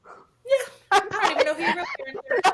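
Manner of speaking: fake-sob the punchline; mock cry. Mom fake-crying as the adopted-kid joke.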